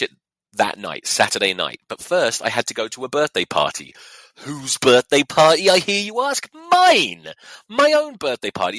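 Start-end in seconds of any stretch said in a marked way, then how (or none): 4.4-8.8 loud, high, hammed-up reveal; mock-indignant announcement. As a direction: build to a shouted punch. Mock-grand reveal of own party.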